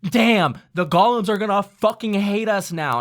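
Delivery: high, loud mock alarm; player quote. Player worries the golems will hate them, mock alarm. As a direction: sell the player's worry big. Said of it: "he was like".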